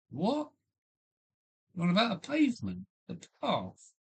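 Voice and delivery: high, fast baffled question; mock disbelief, correcting the word; incredulous retort. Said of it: "I say".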